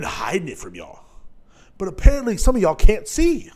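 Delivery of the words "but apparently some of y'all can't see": scolding loud. Real, not acted. Scolding his audience.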